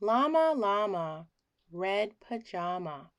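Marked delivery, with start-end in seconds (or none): none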